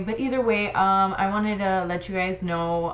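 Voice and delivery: ordinary delivery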